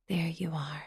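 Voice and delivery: breathy sinister whisper. A narrator's reading. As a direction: hiss it softly over the sleeper. Intruder whispering.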